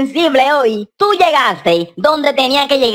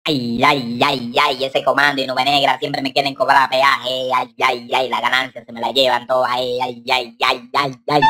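self-pitying low wail; mock lament; 10 semitones lower than usual. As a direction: moan on and on in a low, subdued monotone. Lamenting money taken away from him.

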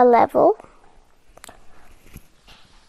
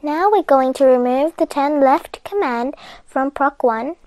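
usual speaking voice

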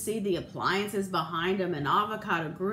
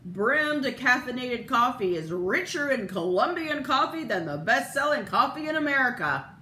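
fast, louder commercial read; mock proud ad voice; ad announcer; 5 semitones higher, 4 dB louder than usual. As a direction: rattle it off like a TV ad. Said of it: "announcer proudly pronounces".

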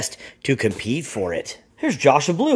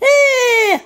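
a short, very high and louder squeal; high shrieking "eh"; 6 dB louder than usual. Acted. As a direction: squeal it out like an annoyed lady. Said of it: "She's like".

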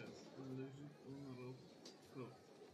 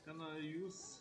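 ordinary talk

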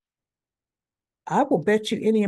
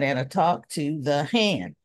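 dismissive brush-off; 7 semitones lower, 3 dB quieter than usual. Retold or imitated. Mock brush-off, drawn out lower.

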